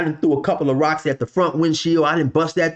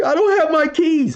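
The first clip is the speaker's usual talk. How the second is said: high, frantic squeak of a lost man; mock his panic, way up high; 3 dB louder than usual. Driver panics over keys.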